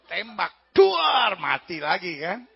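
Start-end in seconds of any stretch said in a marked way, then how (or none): 0.7-1.4 loud, high shouted bang; explosive mock gun blast; imitated gunshot. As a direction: fire off a drawn-out vocal gunshot.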